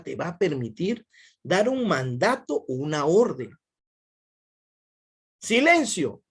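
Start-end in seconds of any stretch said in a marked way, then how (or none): 5.4-6.2 high, loud order for silence; teacher shouting a class to silence; commanding shout. Shown as a demonstration. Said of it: "Ah, está haciendo la función apelativa".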